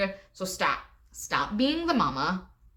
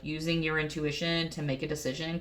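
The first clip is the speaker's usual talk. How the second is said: ordinary talk, nothing marked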